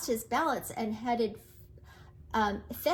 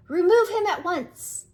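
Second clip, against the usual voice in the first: loud, high furious order; angry command; 6 semitones higher, 9 dB louder than usual. Acted. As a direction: bark the order at the barnyard. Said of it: "demanded the cock angrily".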